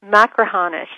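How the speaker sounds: Machrihanish said as mak-ruh-HAH-nish, stress on HAH; correct pronunciation. Shown as a demonstration. Machrihanish said as mak-ruh-HAH-nish, firm falling tone. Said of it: "help me with the pronunciation of".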